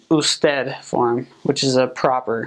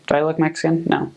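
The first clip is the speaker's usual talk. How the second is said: ordinary talk, nothing marked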